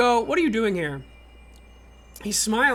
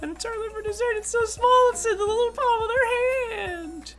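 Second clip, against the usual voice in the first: tiny high voice; 13 semitones higher than usual. Acted. Tiny character described.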